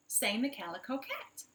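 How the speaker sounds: plain reading voice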